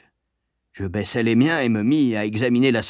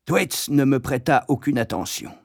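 plain reading voice

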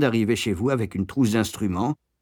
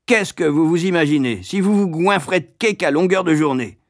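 loud, raised scolding; barking; 7 semitones higher, 4 dB louder than usual. A narrator's reading. Matron scolding the boy for overeating, barking. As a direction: berate the child sharply. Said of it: "aboya-t-elle".

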